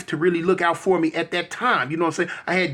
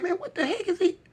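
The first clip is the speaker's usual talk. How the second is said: stunned disbelief; 12 semitones higher, 6 dB quieter than usual. Retold or imitated. Inner reaction to the gift.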